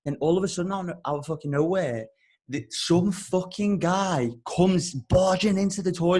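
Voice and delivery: ordinary talk, nothing marked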